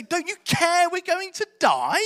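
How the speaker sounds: high, drawn-out indignant question; incredulous protest. Retold or imitated. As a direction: ask in stretched-out disbelief, without shouting. Repeating the disciples' bold question.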